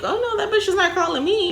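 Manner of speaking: squeaky scared inner voice; high panicked voice. Retold or imitated. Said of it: "I would think".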